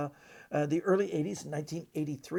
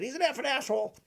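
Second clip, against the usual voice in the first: high, loud outburst in impersonated voice; Vernon; 10 semitones higher, 6 dB louder than usual. Acted. Magician asked about Houdini at a Q&A, blunt high impersonation. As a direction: blurt it out as the blunt old magician. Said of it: "this is my Vernon impression".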